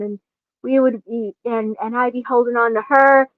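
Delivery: ordinary talk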